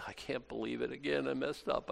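soft, broken-hearted confession; mildly tearful sinner. Retold or imitated. Penitent tells the priest they sinned again, softly tearful. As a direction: quietly confess a repeated failure. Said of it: "they're crying".

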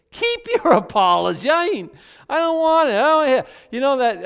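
high, loud, laughing rejection; indignant outburst. Real, not acted. Preacher rejecting the lame apology, loud and high with a laugh. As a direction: throw the apology back in their face. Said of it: "I hate stuff like that".

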